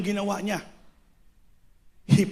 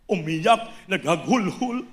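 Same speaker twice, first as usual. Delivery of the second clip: ordinary delivery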